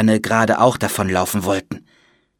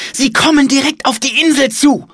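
put-on high, loud child's alarm; alarmed cry; 5 dB louder than usual. A narrator's reading. Child sees ships approaching the island, alarmed cry. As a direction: cry out the danger at the top of the range.

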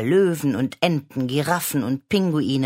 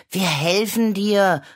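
called out; 6 semitones higher, 4 dB louder than usual. Acted. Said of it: "rief Clara".

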